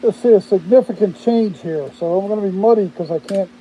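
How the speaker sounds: muffled talk through a mask; speaking with a mask on; through a face mask. Real, not acted. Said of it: "I got the mask on my face".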